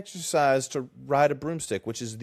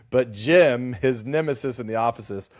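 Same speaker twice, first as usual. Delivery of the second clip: usual speaking voice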